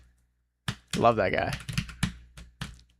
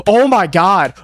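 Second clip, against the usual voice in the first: excited gasp; 5 semitones higher, 7 dB louder than usual. Real, not acted. Tense play.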